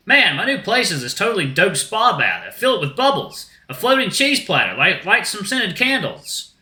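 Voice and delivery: high, stoked dude voice; dreamy shark dude. Acted. Shark describes his spa bath, high dude voice. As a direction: boast like a pampered surfer.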